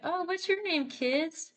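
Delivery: high-pitched gentle character voice, mildly sad; sad scientist. Acted. Scientist asking captive kids their names, high-pitched sad character voice. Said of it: "She's obviously really sad".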